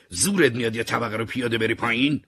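loud, high-pitched scolding; angry shout. A narrator's reading. Angry man yells at a stranger entering the elevator, shouting. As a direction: shout it as an irate challenge. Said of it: "هری داد زد".

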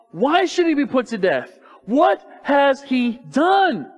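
high, loud demand, slowing at the end; impassioned protest. A narrator's reading. Jonathan answers his father on David's death sentence, loud protest.

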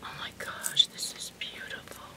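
soft, awed whisper. Real, not acted. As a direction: hush it like showing a treasure. Awed hush over the gift.

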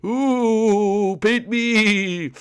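high, drawn-out spooky plea; high ghostly cry, hammed-up; playful ghost. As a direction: wail it like a cartoon ghost. Ghost joke at the easel.

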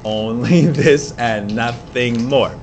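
slow, low dramatic reading of a poem; poem recitation. Shown as a demonstration. Teacher reading Poe to the class, slow and dramatic. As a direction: read it slow and spooky over the music.

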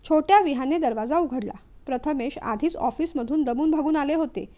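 ordinary delivery, nothing marked

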